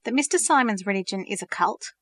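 ordinary delivery, nothing marked

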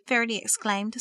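ordinary delivery, nothing marked

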